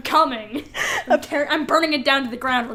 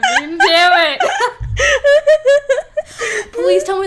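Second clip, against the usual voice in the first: high, loud laughing groan; laughing dismay; 13 semitones higher, 10 dB louder, much slower than usual. Real, not acted. Cohost realising his guess was wrong, laughing loudly. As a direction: crack up at losing.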